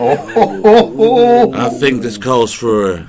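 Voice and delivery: low, slow cowboy voice; Western cowboy. Acted. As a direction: call the duel like a cowboy.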